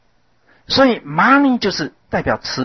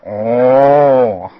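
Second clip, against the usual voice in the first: long drawn mock cow "哦"; cow moo; 3 semitones lower, 4 dB louder than usual. Shown as a demonstration. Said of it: "学牛叫".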